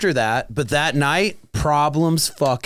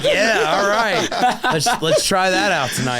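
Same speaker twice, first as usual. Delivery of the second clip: laughing quote; 5 semitones higher than usual. Retold or imitated. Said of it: "And I'm just like".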